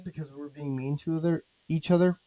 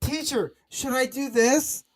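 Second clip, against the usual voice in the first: whiny schoolkid; 7 semitones higher than usual. Retold or imitated. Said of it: "raise your hand".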